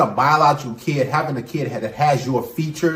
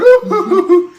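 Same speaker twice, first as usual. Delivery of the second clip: high loud laugh; 6 dB louder than usual. Real, not acted. Laughing at the talk show scene.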